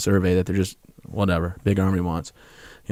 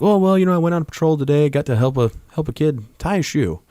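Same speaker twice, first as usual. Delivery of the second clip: much higher, sing-song fake-happy report; mock cheerful; 7 semitones higher than usual. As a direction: parody a chirpy boring update. Mock cheerful phone call home.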